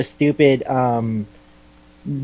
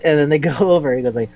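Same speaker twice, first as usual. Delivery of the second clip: ordinary talk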